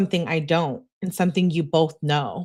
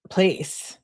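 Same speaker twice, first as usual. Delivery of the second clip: ordinary talk, nothing marked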